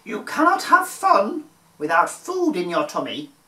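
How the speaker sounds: high, sing-song mum voice; a bright-voiced mother reminding her kids; mummy's voice. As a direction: mum reminding the children about lunch, high and chirpy. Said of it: "said their mummy".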